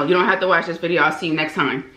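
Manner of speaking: ordinary delivery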